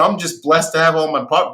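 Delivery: normal talking voice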